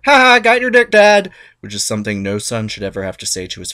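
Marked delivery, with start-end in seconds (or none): 0.0-1.3 high, loud taunting yell; gloating shout. Retold or imitated. Cronus ambushing his father with a gloating yell. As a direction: jump out and gloat. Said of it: "jumping out from behind a rock like".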